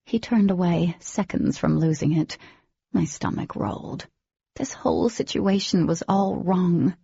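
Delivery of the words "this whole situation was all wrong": ordinary delivery, nothing marked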